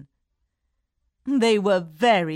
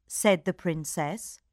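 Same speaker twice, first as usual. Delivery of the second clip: usual speaking voice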